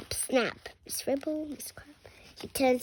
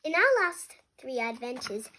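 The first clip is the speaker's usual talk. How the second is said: ordinary delivery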